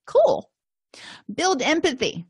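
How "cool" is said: bright high pleased "Cool"; a sudden happy little lift; delighted. Pretend. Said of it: "you're just like".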